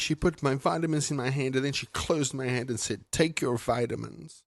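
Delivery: tattle to Daddy in a small, high voice; mock little-kid complaint; imitated tearful child. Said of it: "I hear her wailing and sobbing".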